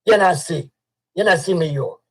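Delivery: lightly put-on Jamaican patois; playing at a Jamaican voice; mock Jamaican accent. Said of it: "I change up the way I'ma talk".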